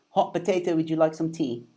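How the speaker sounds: badly faked British English; terrible British accent. Shown as a demonstration. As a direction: offer it primly in bad British. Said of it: "It's a terrible British accent".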